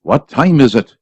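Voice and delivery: usual speaking voice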